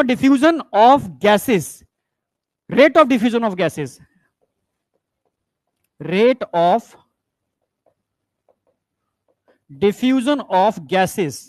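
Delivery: rate of diffusion of gases stretched very slowly; slow, deliberate teacher quiz voice; slow and drawn out. Shown as a demonstration. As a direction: spell the phrase out slowly for the class. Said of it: "directly बोलूं या".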